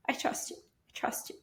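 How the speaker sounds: hushed, almost voiceless whisper; whispered prayer. Retold or imitated. Praying in the shower for her baby, whispered. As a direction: whisper it softly to God. Retold private prayer to God.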